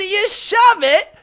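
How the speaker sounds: drawn-out hype call to hit subscribe; hype catchphrase. Acted. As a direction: stretch it out like a big hype shout. Hype sign-off catchphrase to viewers.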